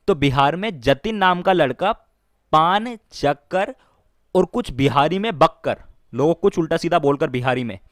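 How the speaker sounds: ordinary talk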